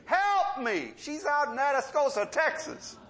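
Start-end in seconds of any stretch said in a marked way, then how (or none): 0.1-0.9 higher mock yell for help; retold cry for help. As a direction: imitate her calling out for help. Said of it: "So she starts screaming".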